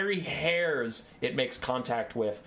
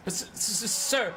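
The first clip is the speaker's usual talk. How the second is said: high, slow, stuttering fright; squeaky nervous stutter; stammering fearful minion; 8 semitones higher, slower than usual. Acted. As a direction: stammer it out, terrified. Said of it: "his commander goes".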